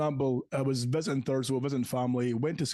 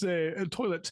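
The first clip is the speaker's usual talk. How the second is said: ordinary talk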